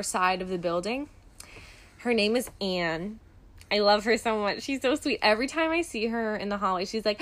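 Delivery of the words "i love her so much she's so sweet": normal talking voice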